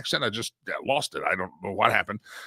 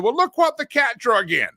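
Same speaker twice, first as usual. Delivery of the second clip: high, louder folksy greeting; teasing Southern welcome, pitched up; quoting the owner; 10 semitones higher, 5 dB louder than usual. Retold or imitated. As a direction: play the cigar shop owner greeting a regular. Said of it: "he walked in and said".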